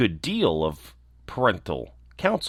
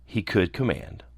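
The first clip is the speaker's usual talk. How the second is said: usual speaking voice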